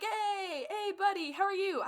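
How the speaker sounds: high, cooing friendly voice, mock teary; cooing Tyrion. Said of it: "He's like".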